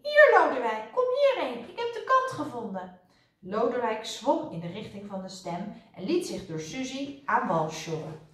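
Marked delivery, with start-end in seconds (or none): 0.0-3.0 high little voice calling loudly; high-pitched, urgent hailing; little mouse voice. A narrator's reading. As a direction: call over the water, small and high. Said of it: "het kleine stemmetje van Suzy".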